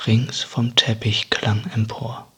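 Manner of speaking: usual speaking voice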